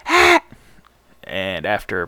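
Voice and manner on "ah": high strained whisper-scream. Shown as a demonstration. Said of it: "Whisper screams".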